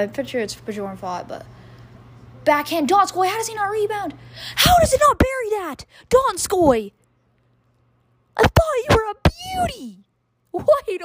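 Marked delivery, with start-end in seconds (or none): 2.4-11.0 much higher, louder disbelieving shouts; excited yell. Real, not acted. Fan yelling at Donskoy for missing, excited shout. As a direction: yell at the miss. Missed open net, yelling.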